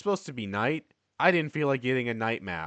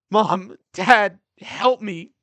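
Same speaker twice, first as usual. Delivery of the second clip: high, loud cry for his parents; screaming for help; 10 semitones higher, 11 dB louder, much slower than usual. Acted. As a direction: scream for mom and dad in panic. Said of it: "Donji then screams".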